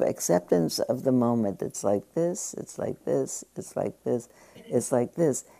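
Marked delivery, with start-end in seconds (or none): 1.6-2.5 put-on softly, like a mother calming a child; soft soothing repetition. Shown as a demonstration. Teacher to class, gently soothing the mind. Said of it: "is like being your own mother". 2.6-3.4 a whispered lullaby to yourself; whispered soothing. Shown as a demonstration. Teacher to class, whispering calm acceptance. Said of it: "is like being your own mother". 3.6-5.4 soft soothing mantra. Shown as a demonstration.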